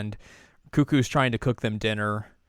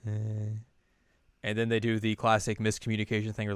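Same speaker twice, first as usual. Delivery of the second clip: ordinary talk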